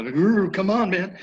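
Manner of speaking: begging the horse to move; impatient urging. Retold or imitated. Rider urging her sluggish trail horse, high and impatient.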